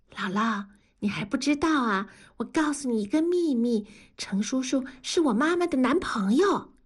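put-on high child's voice, sharing a secret; a small girl proud of her secret; little girl telling a secret. (高声童音，神秘地说秘密)

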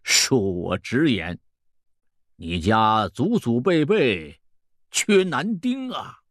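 slow fortune-teller, swelling on key words; old peddler, blunt. Acted. Old peddler telling a customer the family lacks sons, blunt. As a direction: draw out each phrase ominously.

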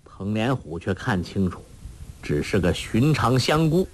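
ordinary delivery

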